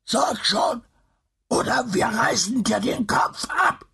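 high, wheezing monster threat; panting creature threat. Acted. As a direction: gasp out the threat like a beast. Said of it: "keuchte die Kreatur".

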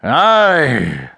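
a touch of a sobbing shout; loud animal sob. A narrator's reading. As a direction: cry out like a wounded beast, understated. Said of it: "he shouted with a terrific loud animal sob".

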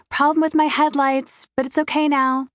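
forced cheerful reassurance. A narrator's reading. Assuring the older man she is fine, quickly and brightly. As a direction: brush it off with a practiced smile. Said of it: "I add quickly".

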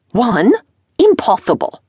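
high, loud indignant disbelief; shocked outburst. A narrator's reading. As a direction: protest that it cannot be done. Dressmaker shocked by deadline.

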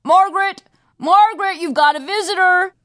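loud, high call to someone upstairs; dad calling his daughter down; calling up the stairs. A narrator's reading. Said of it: "calls up the stairs".

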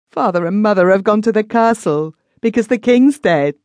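put-on high, tearful little girl's voice; a small child sobbing out her words. A narrator's reading. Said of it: "sobbed the child".

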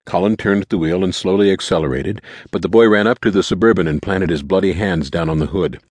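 usual speaking voice

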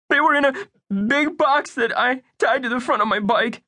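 put-on high, anxious young boy; earnest little boy. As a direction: play Bunky as a worried young kid. Bunky voice.

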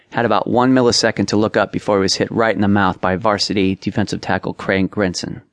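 plain reading voice

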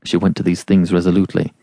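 plain reading voice

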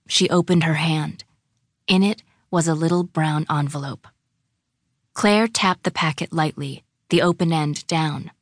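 plain reading voice

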